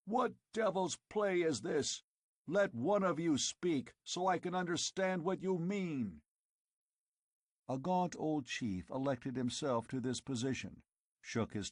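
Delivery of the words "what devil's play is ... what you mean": bellowed. Acted. Conan beating down the din, bellowing.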